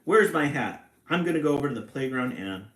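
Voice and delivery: loud, fast, heated; angry rush. A narrator's reading. Papa rushing to confront the bully, loud and fast. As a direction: storm off in a hurry.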